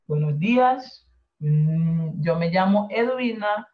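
usual speaking voice

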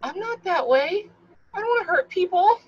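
high-pitched, slowed pleading; imitating own frightened protest; retold fear. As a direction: plead it high, as if terrified of being misjudged. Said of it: "So I was terrified".

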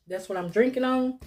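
ordinary talk

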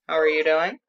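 ordinary talk, nothing marked

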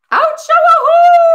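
a loud, high howl at the moon; coyote howl. A narrator's reading. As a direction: throw your head back and howl. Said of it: "he howls".

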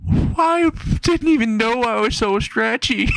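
high-pitched drawn-out wail; hammed-up tearful whine; mock crying. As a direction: cry it out slowly, way up high. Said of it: "crying, saying".

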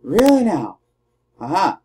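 usual speaking voice

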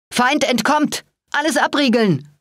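high-pitched alarm call; urgent shouted order at moderate volume; Cooper. A narrator's reading. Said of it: "rief Cooper".

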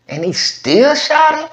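can't believe he still shot him; shocked disbelief. Real, not acted. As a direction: voice jumps up in disbelief. Reacting in disbelief to the news.

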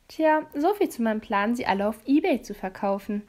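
ordinary delivery, nothing marked

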